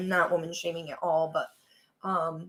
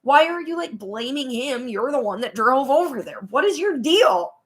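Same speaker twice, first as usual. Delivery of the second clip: exasperated scolding; 4 semitones higher, 5 dB louder than usual. Acted. Viewer blaming Cindy who drove over, indignant. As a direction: take her to task, louder on the questions.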